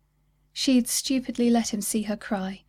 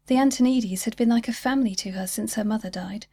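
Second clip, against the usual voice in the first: ordinary delivery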